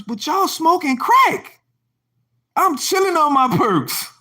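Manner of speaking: incredulous outburst. Real, not acted. Mocking incredulous rant.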